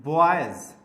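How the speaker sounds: Boys said alone, loud and falling; boys demonstrated. Shown as a demonstration. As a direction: pronounce boys with a clear final z sound. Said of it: "जैसे हमने बोला यहाँ पे".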